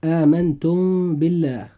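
meem hummed long before the ba of بالله; ikhfa shafawi on the meem of آمنتم. Shown as a demonstration. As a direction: hold a nasal m in آمنتم into the b. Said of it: "الإخفاء الشفوي".

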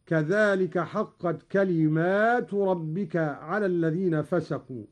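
كلمات with a long alif after the mim; plural reading. Shown as a demonstration. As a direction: recite كلمات in the plural, holding the aa before the final t. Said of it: "بألف بعد الميم على الجمع هكذا". (قراءة كلمات بالجمع بألف بعد الميم)

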